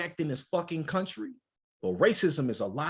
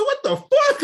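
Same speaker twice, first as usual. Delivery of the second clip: loud, very high shocked outburst; 11 dB louder, slower than usual. Retold or imitated. A man retells his shock at an insult, shouting. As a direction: blurt it out, stunned and loud.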